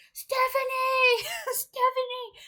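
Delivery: very high, loud drawn-out calling; mock screaming for an idol, with a laugh; fangirl squeal. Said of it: "I was fangirling".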